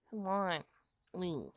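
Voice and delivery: ordinary talk, nothing marked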